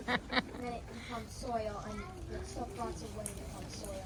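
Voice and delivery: low put-on voice; disguised deep voice. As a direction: drop the voice low, acting it. Kid answering in put-on low voice.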